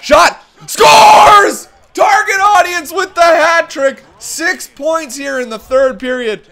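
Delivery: screaming high goal call, slowing on the name; play-by-play call. Acted. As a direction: bellow the hat trick like a TV announcer. Play-by-play of the hat trick.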